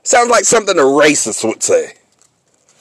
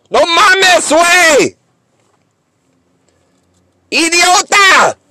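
exasperated insult; 12 semitones higher, 3 dB louder, slower than usual. Real, not acted. Ranting at the team's coach.

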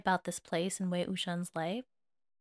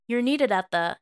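calling through a door; 3 semitones higher, 7 dB louder than usual. Acted.

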